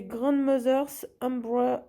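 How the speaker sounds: English title in a real French accent. Real, not acted. Crafter apologises for reading a kit's English title, French accent. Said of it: "Excusez-moi pour mon super accent anglais".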